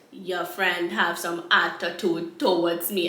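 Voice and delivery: slow, low mock Rastafarian accent; Rastaman accent. Rastaman complaining to her friend, low imitated Rasta accent. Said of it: "in this goddamn accent".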